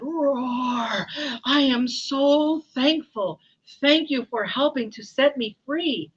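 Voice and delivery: loud roar, then drawn-out thanks; grateful lion. Acted. Lion thanking the mouse who freed him, grateful roar. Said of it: "The lion said".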